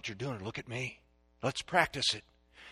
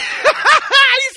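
high, loud mock burst of laughter; imitated laugh; 15 dB louder than usual. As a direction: burst out laughing as the amused driver. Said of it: "he laughed".

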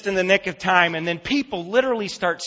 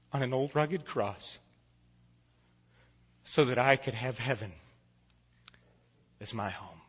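low, soft voice with long pauses; hushed solemn preaching; 6 semitones lower, 10 dB quieter than usual. Real, not acted. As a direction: drop your voice and press the lesson home gravely. Hushed close of the sermon.

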